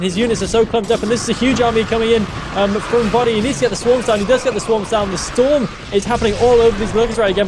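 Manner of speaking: keyed-up live match call; excited play-by-play. Real, not acted. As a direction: call the attack live, building to dismay.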